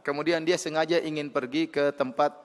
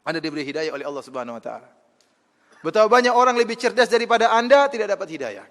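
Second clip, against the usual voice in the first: raised, insistent sermon voice, louder and higher; forceful preaching; 5 semitones higher, 7 dB louder than usual. Acted. Preacher challenging his listeners, loud and forceful. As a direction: drive the point home to the congregation.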